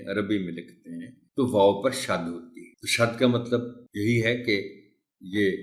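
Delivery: usual speaking voice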